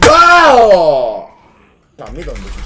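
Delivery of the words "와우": long drawn-out loud wow; excited wow. Real, not acted. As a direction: stretch the wow out in awe. Big play in game.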